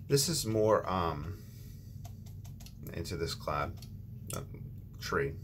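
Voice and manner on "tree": usual speaking voice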